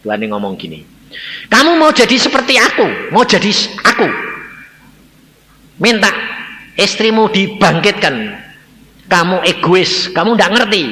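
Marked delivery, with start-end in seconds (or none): 1.5-10.8 loud, high divine rebuke; stern scolding. Retold or imitated. God rebuking the grieving husband for his selfishness, scolding loudly. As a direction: scold him hard, loud and raised. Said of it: "aku dimarahi Tuhan".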